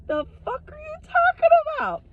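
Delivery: very high, squeaky disbelief; high-pitched baffled protest; incredulous outrage. Acted. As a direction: shoot back in squeaky comic outrage. Outraged reply to boyfriend.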